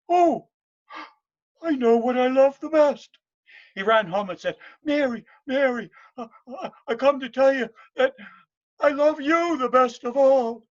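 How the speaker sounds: high loud eager outburst; excited realisation. Acted. Morgan rushing home excited to tell Mary. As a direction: burst out with the discovery.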